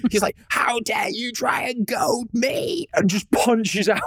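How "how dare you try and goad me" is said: high, slow, soft growly villain voice; hammed-up brute snarling at a traitor; mock outraged warrior; Kravex voice.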